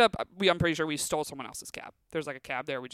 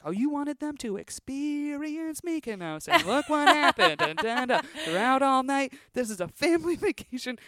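much higher, hammed-up impression of a mad father; mock the furious dad, melodramatic and louder; mocking angry dad; 9 semitones higher, 4 dB louder than usual. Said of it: "He's like".

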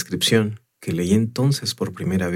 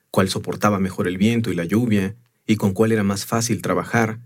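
usual speaking voice

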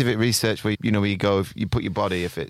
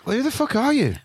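squeaky, much higher disbelieving outburst; amazed; 13 semitones higher than usual. Real, not acted. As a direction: shriek it in disbelief. Amazed at friend's life story.

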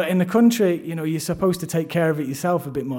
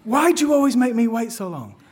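higher, whiny reproach; reproachful wife; 7 semitones higher, 4 dB louder than usual. Retold or imitated. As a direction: complain like she's been left waiting for ages. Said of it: "the first thing she says to me is".

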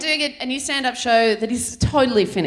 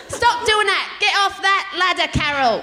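put-on higher, scolding British nanny accent; mock-stern nanny accent, raised pitch; Super Nanny; 4 semitones higher than usual. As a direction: tell a child off as a British TV nanny. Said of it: "Okay, do Super Nanny".

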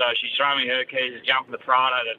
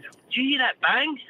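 higher, slower wife voice; worried wife; 11 semitones higher, slower than usual. Retold or imitated. Wife asking her husband about the bang, high and slow. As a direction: ask it like the anxious missus. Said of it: "the missus said".

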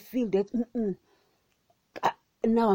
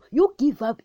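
higher, louder call to give up; insistent repeat; 6 semitones higher, 7 dB louder than usual. Shown as a demonstration. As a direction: push the repeat up and out.

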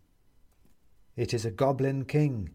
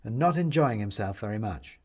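usual speaking voice

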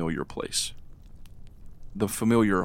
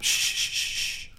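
shushing. Acted. Hushing a baby.

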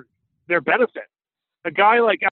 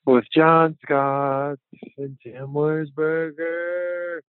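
hammed-up show intro; 4 dB louder, slower than usual. Host welcoming listeners to the hockey podcast, hammed-up intro.

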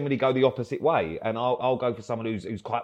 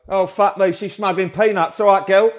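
louder, higher mock leering stepdad; cheeky stepdad; 7 semitones higher, 8 dB louder than usual. Stepdad leering at a son's new girlfriend, loud and high. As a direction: ham up the crude stepdad greeting a girlfriend. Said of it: "he'll go".

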